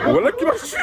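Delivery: high squealing laughter; laughing hard. Real, not acted. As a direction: crack up laughing. Bursts out laughing.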